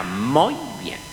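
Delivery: lightly acted teacher's praise; schoolteacher approving. Teacher praising the pupils' answer, approving. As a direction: praise the class as their teacher. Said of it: "la maestra".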